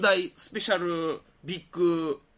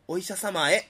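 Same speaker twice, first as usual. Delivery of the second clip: ordinary talk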